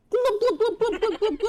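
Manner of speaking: put-on very high, loud fish-girl glubbing; gurgling fish man croak. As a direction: squeak glub glub like a fish. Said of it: "this voice more distinct".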